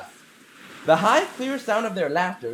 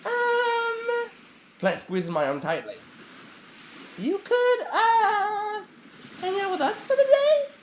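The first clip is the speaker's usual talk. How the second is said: put-on shy girl voice; 13 semitones higher than usual. Plant shy high voice.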